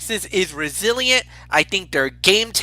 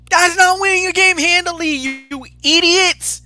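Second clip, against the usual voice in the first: exasperated scolding; 10 semitones higher than usual. Real, not acted. Exasperated at team's near loss.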